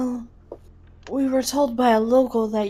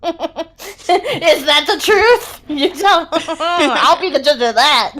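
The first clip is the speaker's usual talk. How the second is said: laughing; 5 semitones higher, 6 dB louder, slower than usual. Real, not acted. Laughing while talking in character.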